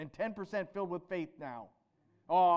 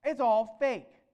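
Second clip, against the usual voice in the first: scornful taunt; 3 semitones higher, 8 dB louder, slower than usual. Acted. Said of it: "These scribes would be taunting".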